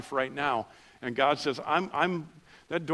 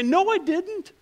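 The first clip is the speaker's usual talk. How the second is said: high, squeaky defensive denial; mock denial; 5 dB louder, slower than usual. Pastor mocks how people deny expectations, squeaky. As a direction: brush it off in a mock-innocent squeak. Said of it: "we say".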